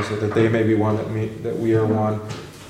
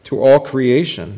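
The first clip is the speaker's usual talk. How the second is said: slow, louder preacher cadence; proclaiming scripture; 3 semitones higher, 6 dB louder, slower than usual. Acted. Teacher reads Mark to students, slow louder proclamation. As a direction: proclaim it to the whole room.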